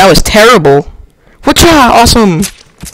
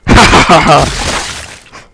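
mock villain; 2 semitones lower, slower than usual. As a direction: play a hammy villain gloating. Villain line at game elf.

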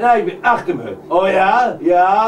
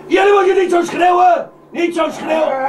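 shouting back; 8 semitones higher than usual. Acted. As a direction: yell back at them in irritation. [name] tells them not to shout.

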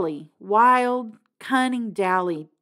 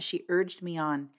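usual speaking voice

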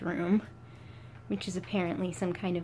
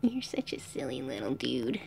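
soft, lower whisper to the pet; tender whisper; 7 semitones lower, 5 dB quieter than usual. Real, not acted. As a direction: whisper it fondly, close in.

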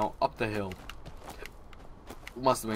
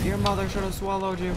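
mock insult; 10 semitones higher, 4 dB louder than usual.

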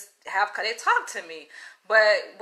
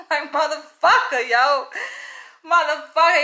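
loud, higher, laughing through the words; laughing disbelief; 6 semitones higher, 6 dB louder than usual. Real, not acted. Woman laughing at the man's sweet-talk, amused disbelief. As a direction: curse him out through real laughter.

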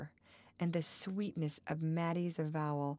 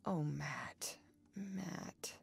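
groaning; 6 dB quieter, much slower than usual. A narrator's reading. Man groaning at his lover's despair, softly anguished. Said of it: "he groaned".

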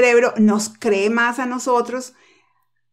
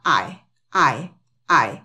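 punched, separate, low-pitched I each time; stress on I; 8 semitones lower, much slower than usual. Shown as a demonstration. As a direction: hit each I as the accented word. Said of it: "El acento está".

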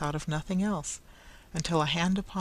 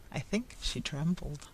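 plain reading voice

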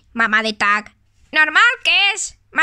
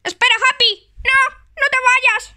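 shouting to stop; 7 semitones higher than usual. Pretend. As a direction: yell at Happy not to eat the cookies. Stops friend eating cookies.

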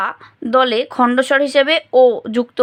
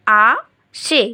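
"আ সে" in two separate beats; syllable by syllable; much slower than usual. Shown as a demonstration. Teacher showing two open syllables, "আ সে" said separately. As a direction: pause between "আ" and "সে". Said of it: "আমরা এক একবারে উচ্চারণ করছি".

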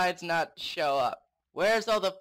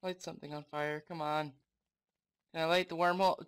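usual speaking voice

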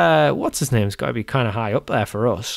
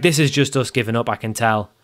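usual speaking voice

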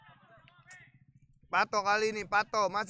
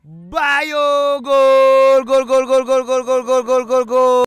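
screaming goal call, high and loud; 5 semitones higher, 15 dB louder, slower than usual. Real, not acted. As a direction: yell the goal with everything.

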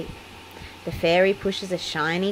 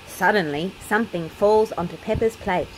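ordinary delivery

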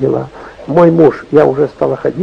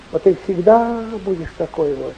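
ordinary talk, nothing marked

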